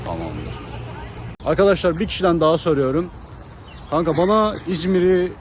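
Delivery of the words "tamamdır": ordinary delivery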